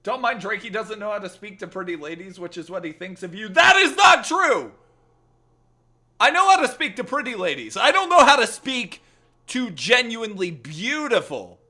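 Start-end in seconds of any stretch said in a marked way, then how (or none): 3.5-7.8 high, loud, slowed protest; indignant shout. Real, not acted. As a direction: shout the denial with wounded pride. Indignant denial.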